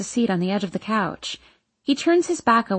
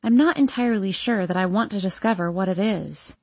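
ordinary delivery

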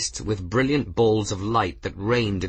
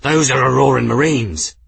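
loud, raised cry of recognition; higher, much louder alarm; alarmed shout; 4 semitones higher, 8 dB louder than usual. A narrator's reading. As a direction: shout a warning in shock. Shock at seeing enemy marines.

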